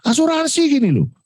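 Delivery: bentakan tiruan bernada tinggi; pura-pura teriak. Retold or imitated. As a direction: tirukan klien yang membentak kesal. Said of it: "oh, langsung bengok". (menirukan bentakan Pak Haryanto yang curiga, nada tinggi)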